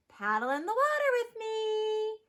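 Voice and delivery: high, inviting call; mother duck calling. A narrator's reading. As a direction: invite the ducklings in with a lifted voice. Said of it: "Mama called".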